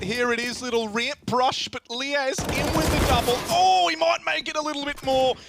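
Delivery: high-pitched excited esports call; live caster getting carried up in pitch; excited play-by-play. Real, not acted. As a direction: call the rush as it lands, voice climbing. Live play-by-play, rush lands.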